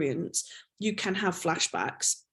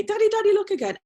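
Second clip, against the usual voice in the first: put-on high-pitched little kid calling for Dad; pestering child; 13 semitones higher, 4 dB louder, faster than usual. Child back to show father the art, insistent. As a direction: put on an eager, much higher child's voice. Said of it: "that child would come back".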